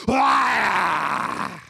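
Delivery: loud, long roar; haka war cry. Retold or imitated. Preacher imitating the All Blacks ending their haka, loud roar. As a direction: let out the team's final roar. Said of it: "And then they go".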